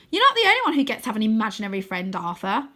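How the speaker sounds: quick, pointed retort; smug. A narrator's reading. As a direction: lift your chin and gloat. Rose proudly raises her chin.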